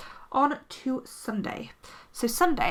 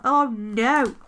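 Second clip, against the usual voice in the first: dismayed oh no; 7 dB louder than usual. Real, not acted. Rips her day extender.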